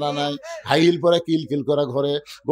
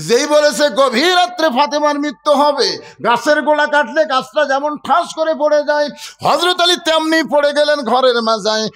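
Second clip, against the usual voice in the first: high, projected dramatic telling; impassioned preaching; 10 semitones higher, 4 dB louder than usual. Real, not acted. Preacher telling how Ali collapsed at the news, impassioned. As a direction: drive the shocking news home.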